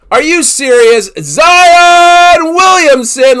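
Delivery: yelling the name out, thrilled; a real stunned, stretched-out yell; excited shout. Real, not acted. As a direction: shout it like the big hit just landed. Big pull revealed on camera.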